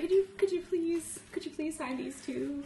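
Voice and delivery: high, wheedling little plea; puppy-dog pleading. Retold or imitated. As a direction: beg sweetly with big sad puppy eyes. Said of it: "sad puppy dog eyes on him".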